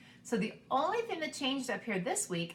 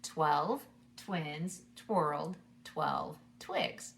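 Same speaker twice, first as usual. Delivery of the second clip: very slow, each word spaced out; nice and slow; 3 semitones lower, much slower than usual. Shown as a demonstration. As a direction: say it slowly for kids to follow. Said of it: "one more time slowly".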